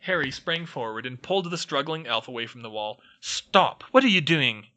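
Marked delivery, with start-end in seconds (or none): 3.2-4.7 hissed. A narrator's reading. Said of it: "he hissed".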